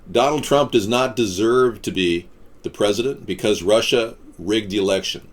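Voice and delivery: ordinary talk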